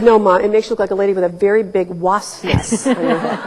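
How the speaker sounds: laughing through a teasing line; amused retelling of a joke to mom. Retold or imitated. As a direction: chuckle while ribbing mom about the hairdo. Teasing mom about her beehive.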